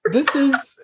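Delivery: put-on lightly posh British baby, high on this is; mock Dorit accent. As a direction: tease like Dorit. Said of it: "be like".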